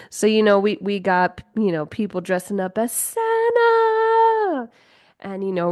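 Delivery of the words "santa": high, long-held showy cry; mock Santa fanfare. Host playing up people dressing as Santa, high drawn-out cry. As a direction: belt it out high and stretch the last vowel.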